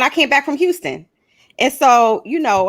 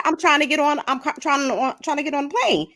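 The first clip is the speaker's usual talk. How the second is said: imitating flustered girl; 4 semitones higher, 4 dB quieter, faster than usual. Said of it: "she said".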